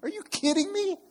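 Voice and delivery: very high, loud frustrated wail; riled up in the car. Retold or imitated. Pastor retelling yelling while driving, high strained wail. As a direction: let it crack like you're punching the air. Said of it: "punching the air".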